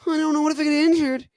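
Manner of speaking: high, fast, anxious gasping worry; mock panic, high and breathless; anxious panting. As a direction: pant out the doubt in a high fretful voice. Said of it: "if I'm going".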